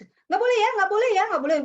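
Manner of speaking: high, loud repeated warning. Shown as a demonstration. Teacher showing a high-toned ban to an echolalic child, high tone. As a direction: raise the pitch sharply, keep it forceful. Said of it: "Ketika saya menggunakan nada tinggi".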